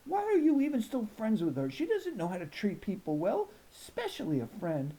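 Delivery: a hint of a tough grandma scolding; grandma scolds Danielle about her friend, lightly voiced; stern grandma. A narrator's reading. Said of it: "Grandma said".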